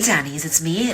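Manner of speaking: ordinary talk, nothing marked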